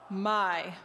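"my" dropped low in the voice. Shown as a demonstration. Showing group two the Thai low tone, low pitched. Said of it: "your tone is low".

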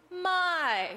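"my" starting high and falling down. Shown as a demonstration. Pastor showing groups three and four the Thai falling tone. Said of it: "the falling tone".

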